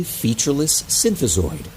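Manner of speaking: usual speaking voice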